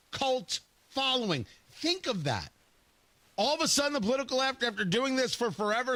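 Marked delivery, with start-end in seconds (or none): none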